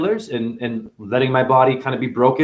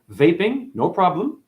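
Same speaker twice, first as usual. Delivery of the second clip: usual speaking voice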